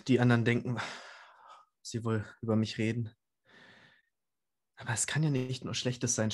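usual speaking voice

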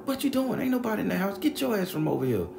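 very high-pitched telling-off; imitated scolding neighbor. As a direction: chase an intruder away, in mimicry. Neighbor chases him off.

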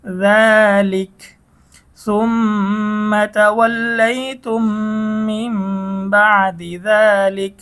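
slow chanted tilawat; Quran recitation. Shown as a demonstration. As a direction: recite the verse drawn out. Recites the verse slowly. (ধীর তিলাওয়াত)

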